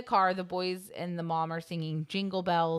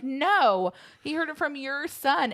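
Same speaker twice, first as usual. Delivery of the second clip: raised, emphatic mother's retort; mock accusation; 8 semitones higher, 5 dB louder than usual. Ralphie's mom blaming Schwartz, mock accusation. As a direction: accuse in a high, acted voice.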